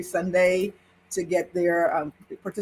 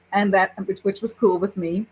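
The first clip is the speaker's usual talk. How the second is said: ordinary delivery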